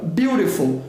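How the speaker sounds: "beautiful" with the T tapped like an R; flap T. Shown as a demonstration. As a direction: say "beautiful" as "biurifol". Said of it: "Som de R nele".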